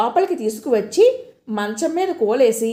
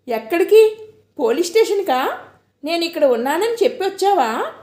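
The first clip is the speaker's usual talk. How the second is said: sharp, louder, higher demand; angry questioning; 6 semitones higher, 3 dB louder than usual. A narrator's reading. Girl suspects her friend went to police, angry. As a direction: fire the questions at him. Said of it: "కోపంగా అడిగాను".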